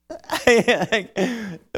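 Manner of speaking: a real burst of high laughter at his own slip; laughing. Real, not acted. Preacher stuck on a word laughs at himself out loud. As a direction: laugh at yourself, caught stumbling on a word.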